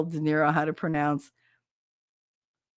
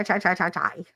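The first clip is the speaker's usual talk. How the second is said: ordinary delivery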